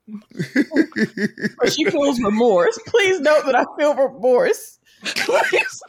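helpless laughter breaking into the apology; laughing remorse. Real, not acted. Host begging listeners to note her regret, laughing. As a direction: plead through giggles.

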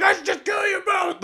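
high, manic Cage yell; crazed Nicolas Cage. Retold or imitated. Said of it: "going crazy".

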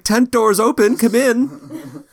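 high sing-song call from inside the tent; a bright, high shopkeeper calling through the tent. Acted. Shopkeeper calls visitors in, high sing-song voice.

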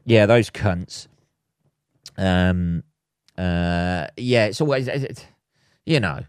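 usual speaking voice

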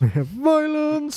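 high-pitched mock dramatic cry; silly high echo. Podcaster joins co-host echoing the word, silly high echo. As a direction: sing it out high and playful.

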